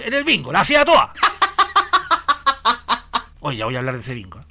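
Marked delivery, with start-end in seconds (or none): 1.2-3.3 high, loud real laughter; hearty high laugh; big laugh. Real, not acted. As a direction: crack up at your own joke. Laughs at his own bingo joke.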